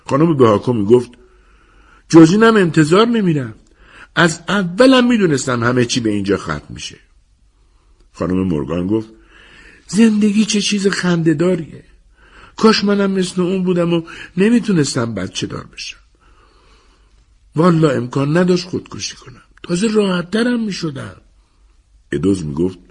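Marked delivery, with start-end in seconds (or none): 2.1-7.0 high-pitched woman gossiping; neighbour woman's voice. A narrator's reading. Narrator voices Mrs Bhakku. 9.9-21.2 very high-pitched woman's voice; bitter neighbour woman. A narrator's reading. Narrator voices Mrs Morgan.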